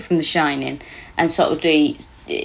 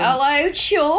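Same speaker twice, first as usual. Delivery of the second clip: put-on Scouse accent; 7 semitones higher, much slower than usual. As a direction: put on an exaggerated Scouse greeting.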